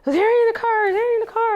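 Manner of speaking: high, loud frantic repeated question; imitating a panicked mom on the phone; worried friend asking if her daughter was in the car, panicked; panicked mother. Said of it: "she's like".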